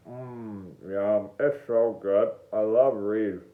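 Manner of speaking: low savoring mumble with a reed in the mouth; low, drawn-in mock enjoyment; mock relish.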